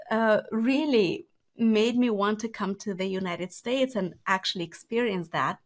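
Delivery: normal talking voice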